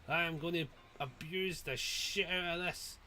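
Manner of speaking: usual speaking voice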